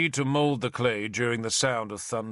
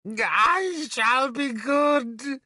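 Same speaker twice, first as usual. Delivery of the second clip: very slow, high, louder desperate plea; 14 semitones higher, 4 dB louder, much slower than usual. Acted. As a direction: drag it out in desperation. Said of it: "he said in desperation".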